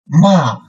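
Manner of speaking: ma falling sharply from high; fourth tone. Shown as a demonstration. Teacher models ma for repetition, falling fourth tone. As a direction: start ma high and drop it suddenly. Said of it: "Okay, read again".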